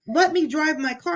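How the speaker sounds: half-hearted retold shout; retold yelling. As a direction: imitate a driver yelling at his riders. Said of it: "he's yelling at us".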